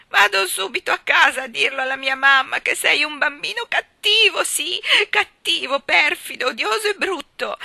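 put-on very high, loud wailing child; tearful tantrum. A narrator's reading. Ivy denounces Gerald after the prank, tearful wailing. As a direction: bawl the insults through tears, stretching them. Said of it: "disse Ivy tra le lacrime".

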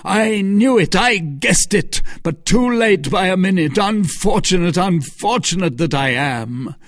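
loud, high anguished outburst; crying out. A narrator's reading. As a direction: wail with furious regret. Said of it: "seized him by the throat, crying".

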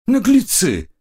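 high indignant squeal; shriek. A narrator's reading. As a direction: break into a shriek. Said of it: "сорвалась на визг".

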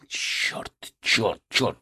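ordinary delivery, nothing marked

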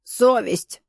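a hint of a growling old man; lightly growled single word. A narrator's reading. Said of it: "прорычал старик".

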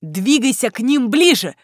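loud call over noise; shouting. A narrator's reading. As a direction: shout right into her ear. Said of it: "крикнул он мне в ухо".

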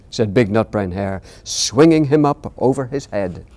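put-on gentle read-aloud for children; storybook reading. A narrator's reading. Author reading his bedtime book aloud to interviewer, gentle storytelling.

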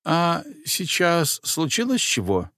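put-on high, worried old woman; fretting that something went wrong. A narrator's reading. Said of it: "взволнованно спросила".

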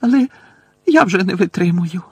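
tearful surrender; distressed babbling. A narrator's reading. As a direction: give in as if overwhelmed. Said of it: "пробелькотіла Герміона".

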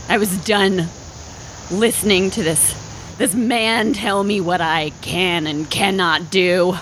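ordinary delivery

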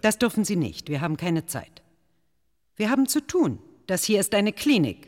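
indignant nurse ordering them out; stern scolding. Acted. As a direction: put them in their place, this is a clinic. Head nurse throws out intruders.

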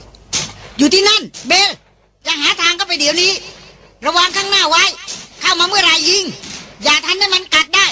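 high, urgent shouted orders; urgent call. Acted. Hunter calling across the fire to Bel, urgent shout. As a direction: call across the flames, commanding her to hold on.

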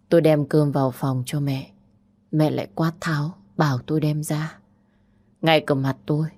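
plain reading voice

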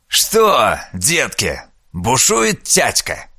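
put-on creaky, slow old grandpa; creaky old man. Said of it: "проскрипел дед".

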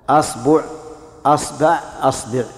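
أصبع said with a as the first vowel, the b taking u, then a, then i: asbu', asba', asbi'; three vowel variants. Shown as a demonstration. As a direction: say أصبع with a at the start and run the b through u, a, i. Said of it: "خذوا فتح الهمزة وتثليث الباء". (أصبع بفتح الهمزة وتثليث الباء)

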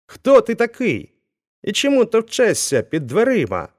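a gruff smith growing sterner. A narrator's reading. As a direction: bark it at the stranger at the door. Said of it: "промовив коваль ще суворіше".

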